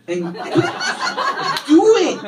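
put-on Mexican-accented order bursting into a high, loud finish; angry Mexican wife. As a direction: imitate an exasperated wife, exploding at the end in a Mexican accent. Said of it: "when she's angry".

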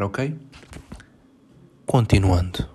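normal talking voice

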